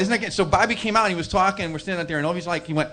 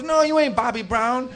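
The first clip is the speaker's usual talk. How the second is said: slow, higher mock contradiction; flat denial; 6 semitones higher, 4 dB louder than usual. Cook contradicts Bobby in the argument, mock stubborn. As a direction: draw out the retold denial.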